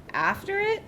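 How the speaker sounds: ordinary delivery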